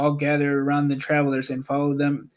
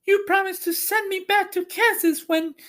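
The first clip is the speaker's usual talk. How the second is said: put-on much higher little-girl voice; a child holding someone to his word. A narrator's reading. Dorothy reminding Oz about going back to Kansas, high and childlike. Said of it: "said the girl".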